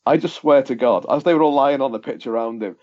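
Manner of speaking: normal talking voice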